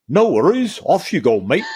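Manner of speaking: a light imitation of a New Zealand accent, opening high; breezy New Zealand accent, tossed off casually; mock Kiwi accent. Imitating a relaxed New Zealander saying yes, in a mock Kiwi accent. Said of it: "that's gotta be the best accent I've heard yet".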